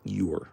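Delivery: "You're" said alone as "yoo-er"; contraction "you're". Shown as a demonstration. Giving the correct word, "You're" as a short "yoo-er". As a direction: give "You're" two sounds, yoo-er. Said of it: "it's not gonna be that".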